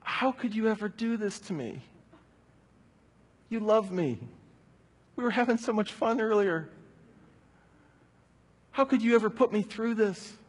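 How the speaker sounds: put-on a betrayed toddler begging why; hurt child's plea. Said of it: "as if he was saying".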